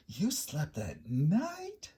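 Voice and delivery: slowly dragged astonished question; gasping. A narrator's reading. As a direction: gasp it out in disbelief. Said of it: "gasped another".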